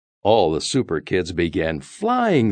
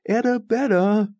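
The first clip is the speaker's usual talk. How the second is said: calling out. A narrator's reading. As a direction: call into the forest for a friend. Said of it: "Now Icky called".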